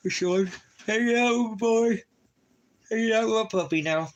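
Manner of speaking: very high, loud falsetto gushing. Real, not acted. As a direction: squeal it fondly at the puppy. Cooing to his dog.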